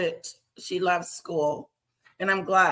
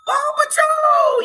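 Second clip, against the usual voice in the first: high, loud and slow, cheering it; excited shout; 7 dB louder than usual. Real, not acted. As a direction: shout it out like a fan. Excited shout of show name.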